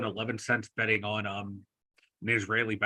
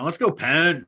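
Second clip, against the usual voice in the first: team cheer; 5 semitones higher, 9 dB louder, slower than usual. Real, not acted.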